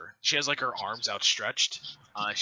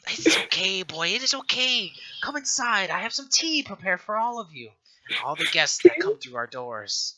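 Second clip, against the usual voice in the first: put-on higher old woman, hospitable; welcoming old matron; 5 semitones higher, 6 dB louder than usual. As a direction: usher the guests in for tea. Matron invites them in.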